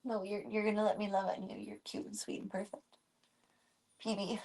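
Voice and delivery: whisper-soft affection; tender murmur. Real, not acted. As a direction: murmur sweetly to the kitten.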